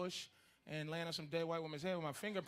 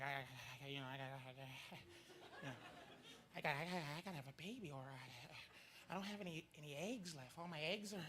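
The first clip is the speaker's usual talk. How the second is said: much softer, low voiced girlfriend in a panic; panicking girlfriend; 4 semitones lower, 11 dB quieter than usual. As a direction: fret about the eggs. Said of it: "she's like".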